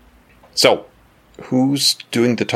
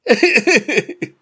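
high squeaky laugh. Acted. Servant voice dissolves into laugh.

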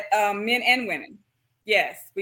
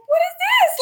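high, loud startled cry breaking into laughter; panicked surprise; 7 dB louder than usual. Retold or imitated. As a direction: relive the shock of not being able to breathe, half laughing. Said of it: "And I'm like".

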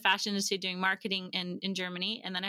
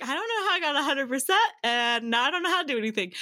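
quick, louder mock bewilderment through a laugh; laughing self-quote; 3 semitones higher, 4 dB louder, faster than usual. Recalling failed Spanish to the host, laughing self-mockery. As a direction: laugh while quoting your own cluelessness.

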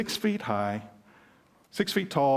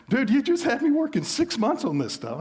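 high-pitched, louder mock outrage from a trainee; exasperated disbelief; 11 semitones higher, 4 dB louder than usual. Trainee protesting to the teacher in mock outrage. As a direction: play the stunned trainee who just wasted six months. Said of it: "I was sitting there like".

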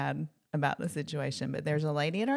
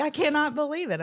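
higher-pitched mock disbelief; retold shock; 8 semitones higher than usual. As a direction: replay being stunned on the phone, pitched up. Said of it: "I'm in shock".

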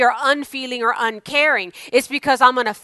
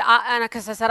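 ordinary talk, nothing marked

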